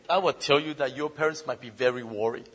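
normal talking voice

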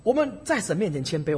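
usual speaking voice

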